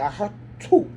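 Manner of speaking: mimed spit, breathy hock then "too"; imitated spitting sound; spitting demo. Shown as a demonstration. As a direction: demonstrate a hock-and-spit noise. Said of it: "Like, I did it like that".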